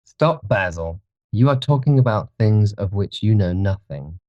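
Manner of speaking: a cold note of contempt; restrained disdain, putting him in his place; contemptuous. A narrator's reading. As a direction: cut Basil off with understated scorn. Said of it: "with a note of infinite contempt in his voice".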